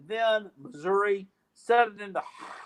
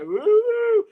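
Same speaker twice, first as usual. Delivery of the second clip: renewed hope; 5 semitones higher, 6 dB louder than usual. Retold or imitated. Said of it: "I was like".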